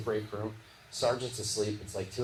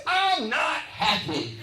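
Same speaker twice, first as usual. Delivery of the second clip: Little Larry; 8 semitones higher, 5 dB louder, much slower than usual. Retold or imitated. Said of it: "And Larry goes, he was, he laughed, then he goes".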